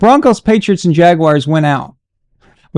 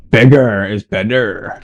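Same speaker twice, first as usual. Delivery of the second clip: mock deep; 8 semitones lower, slower than usual. Joking aside, low slow.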